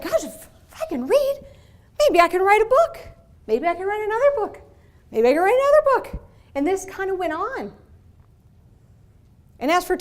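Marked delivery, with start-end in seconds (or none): 0.0-3.1 much higher, wide-eyed rising hope; excited inner thought. Retold or imitated. Woman recalling learning to read, giddy and high. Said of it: "I was like". 3.5-4.6 excited daydream. Retold or imitated. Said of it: "I was like". 5.1-6.2 higher, louder and fast, bursting with glee; building excitement. Retold or imitated. Speaker imagining more books, gleefully higher and louder. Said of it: "I was like".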